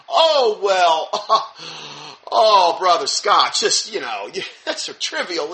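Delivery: scoffing, laughing it off; mock the brushoff with a chuckle; mocking dismissive.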